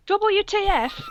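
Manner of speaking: very high-pitched outraged cry; shouted angrily. A narrator's reading. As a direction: squeal the letters in mock fury. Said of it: "I shouted angrily".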